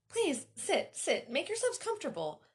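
high, over-eager invitation; awkward. A narrator's reading. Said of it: "I said awkwardly".

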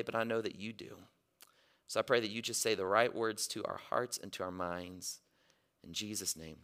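hushed, earnest prayer. Real, not acted. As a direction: pray softly and low, trailing down to a hush. Leads the congregation in prayer.

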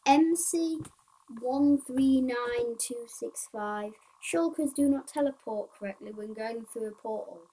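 slow, loud bug-list read-out; reading aloud. Acted. Player reading bug numbers and fixes to viewers, reading aloud. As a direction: read each item out loudly, drawing it out.

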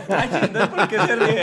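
high, laughing mock lady; hammed-up fussy bystander; mock passenger. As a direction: ask it through giggles. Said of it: "¿Y qué tiene?".

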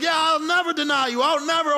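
hammed-up boast of loyalty; eager Peter. Peter boasting to Jesus, mock eager promise. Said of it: "So Peter's like".

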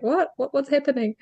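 a touch of flustered alarm; retold shock. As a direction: replay the moment of confusion. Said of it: "very much mentally like".

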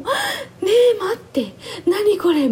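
choked-up wait, what is this; tearful amazed studio reaction; moved outburst. Retold or imitated.

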